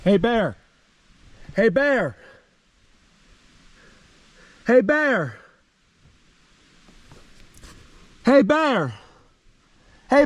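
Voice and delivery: loud, high call to the bear; scaring off a bear. Real, not acted. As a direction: shout to chase the bear away. Shouting to scare off bear.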